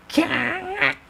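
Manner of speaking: very high choked shriek; a sudden strangled cry; villain shrieking as the knife cuts; pained shriek. Acted. Scream as he is cut.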